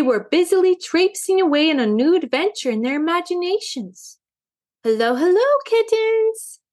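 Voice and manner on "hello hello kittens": singsong call climbing high. A narrator's reading. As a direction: call the kittens back, pitching the last word up. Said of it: "called Madame Meow".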